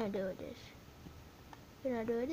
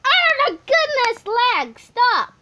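very high, loud yell at the lag; frustrated shriek; 11 semitones higher, 17 dB louder, slower than usual. Real, not acted. Kid gamer yelling at the laggy game, loud and shrill. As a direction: scream for it to stop.

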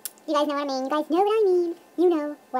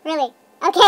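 ordinary talk, nothing marked

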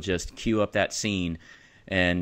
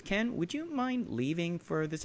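polite request; 8 semitones higher, 7 dB quieter than usual. Retold or imitated. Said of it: "the director politely asked".

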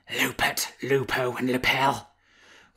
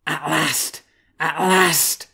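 moaning; 5 semitones higher, 6 dB louder, much slower than usual. A narrator's reading. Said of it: "she moaned".

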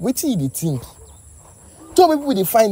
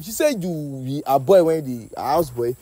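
ordinary delivery

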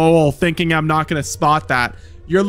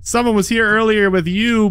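usual speaking voice

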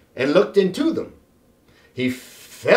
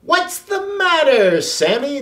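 worried calling; 7 semitones higher than usual. A narrator's reading. Children and teacher calling to the upset pet. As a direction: call out to the pet in alarm. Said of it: "they cried".

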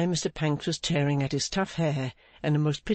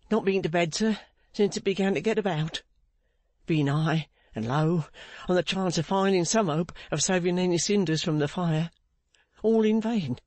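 higher-pitched, worn-out lament; despairing Pancks; 4 semitones higher than usual. Acted. Pancks telling Clennam of a vain search, despairing. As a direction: recount the fruitless search, rising in despair.

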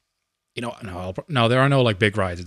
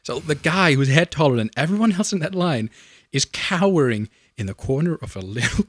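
ordinary talk, nothing marked

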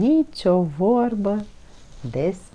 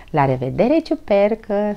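giggling goodbye; 6 semitones higher, 6 dB louder than usual. A narrator's reading. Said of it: "spuse el chicotind".

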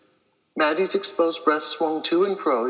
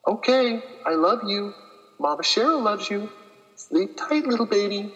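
put-on goodnight to baby; 5 semitones higher than usual. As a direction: say goodnight sweetly to the unborn baby.

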